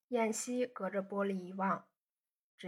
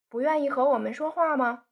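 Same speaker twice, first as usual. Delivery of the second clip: urgent plea; 5 semitones higher, 8 dB louder, faster than usual. A narrator's reading. Xiuzhu hurriedly calling him back.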